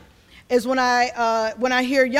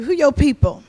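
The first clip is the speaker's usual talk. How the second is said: lightly voiced Southern elder's question, louder; Southern folk asking; 7 dB louder than usual. Folk in the South questioning a newcomer, lightly voiced pointed question. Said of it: "folk gonna ask you".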